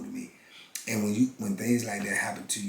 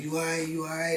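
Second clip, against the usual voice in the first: a hint of a concerned female guard, higher; lightly voiced officer asking twice, higher; 7 semitones higher than usual. Guard at the booth checks on grieving inmate, lightly imitated higher.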